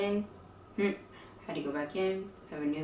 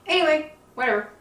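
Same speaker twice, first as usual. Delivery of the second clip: exasperated; 10 semitones higher, 10 dB louder, much faster than usual. Real, not acted. Exasperated brush-off of hospital.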